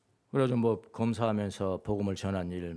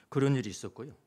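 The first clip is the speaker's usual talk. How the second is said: usual speaking voice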